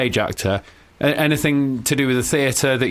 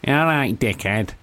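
nasal Manchester jibe; Manchester accent; 2 semitones lower, slower than usual. Shown as a demonstration. Host copies the caller's phrase, nasal Manchester accent. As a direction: put it in the nose, Manchester style. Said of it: "Real nasal, innit?".

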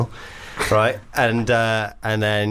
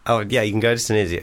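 ordinary talk, nothing marked

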